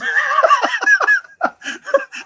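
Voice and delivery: high, loud shrieking laugh. Real, not acted. Friend shrieks with laughter at the flight time. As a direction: let out a shrill burst of laughter.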